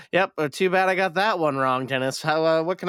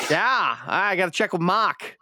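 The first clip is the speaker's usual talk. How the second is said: slow, thick Boston accent imitation; slow mock Boston accent; 4 semitones higher than usual. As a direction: drawl it like a big Boston guy. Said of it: "It's like your stereotypical".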